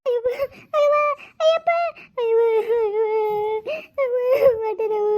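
high sobbing cries; crying in pain. Acted. Woman in labor, crying in pain to her mother. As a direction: sob out the pain.

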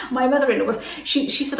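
ordinary delivery

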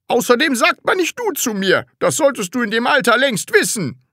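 high, fast scolding schoolmaster; irate strict teacher. Acted. Teacher correcting pupil's manners, irate and lecturing.

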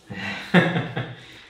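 short real laugh. Real, not acted. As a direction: let a laugh slip out. Laughs during treatment. (risata breve e spontanea)

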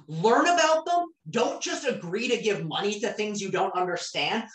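ordinary talk, nothing marked

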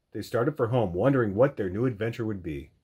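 ordinary delivery, nothing marked

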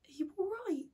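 soft, high, gentle question; kind concern. Acted. Prime Minister asking the tearful girl, softly kind. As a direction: check on someone gently, almost under your breath. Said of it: "All sort of kind".

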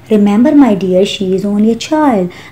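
usual speaking voice

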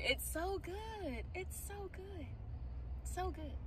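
soft, breathy savouring; mmm, so good; savouring murmur. Real, not acted. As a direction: melt over the taste. Savouring the food.

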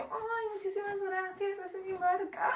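very high, soft, mock-bashful squeal; gushing thanks. Vlogger thanking the security man, high gushing voice. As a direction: replay a flattered thank-you in a tiny high voice. Acts out her gushing reply.

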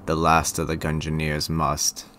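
low, soft storyteller; solemn game-intro narration; story narration. Acted. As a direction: read the legend low and hushed, with gravity. Reads game's opening narration.

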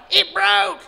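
high, loud dumb reply. Retold or imitated. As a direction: answer like a dimwit. Melvin answers.

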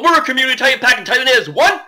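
hyped, drawn-out host slogan; big swooping YouTuber farewell; showy catchphrase sign-off. Acted. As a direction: belt out the channel motto with stretched key words.

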